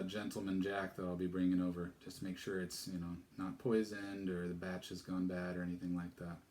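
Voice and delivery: plain reading voice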